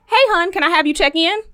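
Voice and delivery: a mild impression of a chirpy receptionist; imitating the desk lady.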